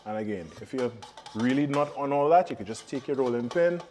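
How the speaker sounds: normal talking voice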